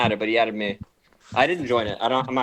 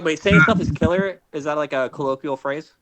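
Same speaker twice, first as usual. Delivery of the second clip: ordinary talk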